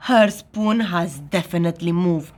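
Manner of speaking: usual speaking voice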